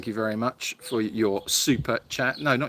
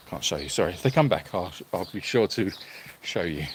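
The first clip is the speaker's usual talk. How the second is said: ordinary talk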